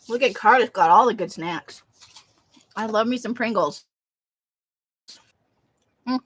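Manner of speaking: talking while crunching chips. Real, not acted. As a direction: mouth full of crunchy chips. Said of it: "I'm sorry about the crunching".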